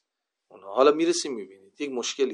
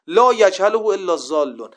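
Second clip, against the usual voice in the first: imitated shout, loud, high and drawn out; raised voice; 6 semitones higher, 8 dB louder than usual. Imam declaring to Abu al-Jarud who has gone astray, raised voice. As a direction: proclaim it slowly at a raised pitch, as the Imam would.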